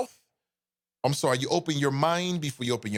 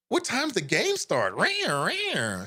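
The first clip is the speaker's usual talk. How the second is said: high, drawn-out little tiger voice with rawrs; mock a harmless football mascot; timid mascot impression; 8 semitones higher, much slower than usual. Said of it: "The Bengals mascot is kinda like".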